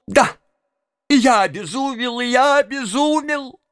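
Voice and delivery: high-pitched raving outburst; frenzied. A narrator's reading. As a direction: rave in a high, distraught voice. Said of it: "в величайшем бешенстве". (в бешенстве, высоким голосом)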